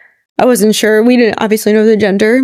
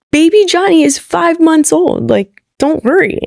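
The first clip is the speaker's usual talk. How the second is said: higher, slow, put-on innocent voice; mock innocent; 7 semitones higher, slower than usual. To the family, hiding the pregnancy, higher, slower and sweetly innocent. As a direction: play it sweet and innocent to throw them off. Retold innocent cover-up to in-laws.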